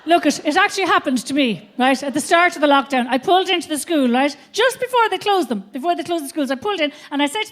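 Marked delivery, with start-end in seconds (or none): none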